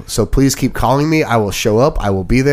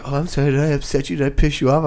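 slightly raised mimicking voice; mock apology; 4 semitones higher, faster than usual. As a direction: imitate someone fishing with a fake sorry. Mimics the same apology again.

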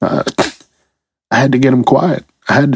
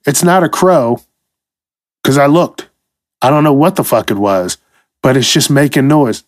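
usual speaking voice

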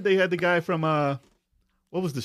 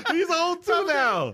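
laughing; 13 semitones higher, much slower than usual. Real, not acted. Laughing high voices.

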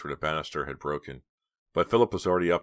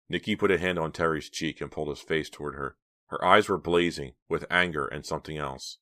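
ordinary delivery

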